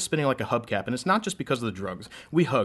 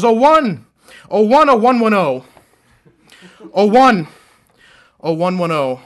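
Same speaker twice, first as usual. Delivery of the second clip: shout fading to hush; 12 dB louder, slower than usual. Acted. Binary sonnet, shout to hush.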